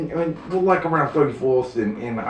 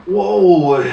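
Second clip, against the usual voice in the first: mock startled whoa; 7 semitones higher, 9 dB louder than usual. Cops react to the threat.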